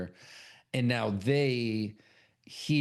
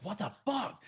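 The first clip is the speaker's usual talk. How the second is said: acted high, incredulous outburst; high-pitched disbelief; 10 semitones higher, 4 dB quieter than usual. [name] confronting Tony and Mac, acted disbelief. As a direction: send the question up high without shouting.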